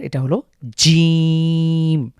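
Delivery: জিম said slow and long, firm; demo of jim. Shown as a demonstration. Tutor introducing the letter জিম with a long firm hold. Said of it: "একটু long করে পড়তে হবে".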